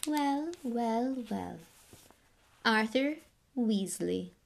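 lightly voiced, slow silky disdain; cold aristocrat. Lucius greeting Arthur, lightly voiced cold disdain. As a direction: greet him like something unpleasant.

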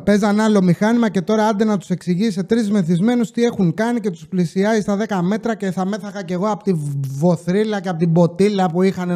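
usual speaking voice

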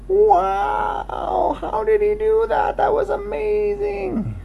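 high, amazed little voices; amazed crowd. Acted. Animals marvel at the jump in high amazed voices. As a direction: gasp it out like a wowed crowd.

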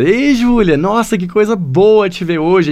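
high, bright-toned warm greeting; lively intonation. Shown as a demonstration. Teacher showing the same greeting with lively intonation. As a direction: greet her with real joy in the melody. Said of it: "A diferença, fala".

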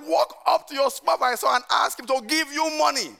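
insist, pushing each word harder. Retold or imitated. Telling Peter on the phone to ask his boss, insistent. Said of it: "I said".

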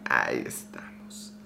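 breathy whispered aside; quick whispered aside to the camera. Real, not acted. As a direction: whisper it under your breath. Camera moved, quiet aside.

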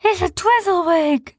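high, slow, fearful; name the dread creature; scared Sniz. A narrator's reading. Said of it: "said Sniz".

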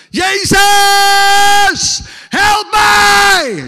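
high, very loud drawn-out cry for help; desperate scream. Retold or imitated. Addicted man cries out to Jesus for rescue, desperate scream. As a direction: scream it out, holding each last syllable. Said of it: "Ik heb het uitgeschreeuwd naar Jezus".

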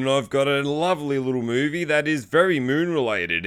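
ordinary delivery

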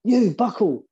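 put-on screaming Scottish manager accent. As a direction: bark it across at the other bench in a Scottish accent. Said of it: "And he's going in a Scottish accent".

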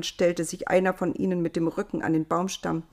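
usual speaking voice

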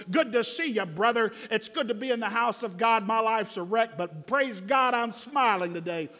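ordinary talk, nothing marked

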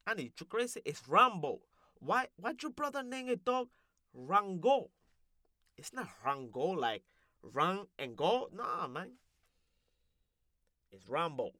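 put-on accent, baffled and insistent; indignant comic character. Character arguing with Annie over a dog's name, baffled and insistent. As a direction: argue the point as an accented loudmouth.

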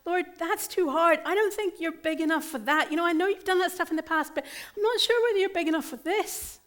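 mock worried believer pleading; hammed-up anxious protest, rushing; anxious complaining voice. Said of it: "don't harden your heart and go".

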